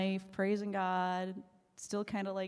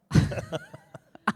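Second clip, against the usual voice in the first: high squealing laugh of relief; joyful high-pitched laugh; big burst of laughter; 5 dB louder than usual. Retold or imitated. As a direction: shriek with delighted laughter, falling over. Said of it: "I'm, like, falling over".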